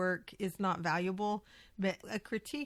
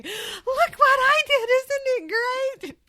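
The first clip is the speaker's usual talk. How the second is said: very high, loud, slow squeal of pride; squealing mock pride; 12 dB louder, much slower than usual. A host mocking how she shows off her work, squealing high and slow. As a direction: stretch it out like a gleeful child.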